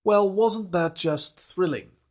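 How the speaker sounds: high, loud and slow sarcastic gush; drawn-out ironic thrill; mock enthusiasm. Real, not acted. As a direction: oversell it with a wink. Host ironically wraps up the reading.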